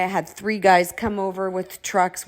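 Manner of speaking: ordinary delivery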